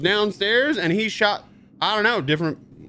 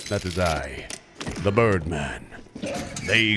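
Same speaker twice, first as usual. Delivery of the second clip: low, grand self-introduction, then slow; Birdman persona; 11 semitones lower, 5 dB quieter than usual. Pretend. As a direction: announce yourself as a superhero. Introduces his character Birdman.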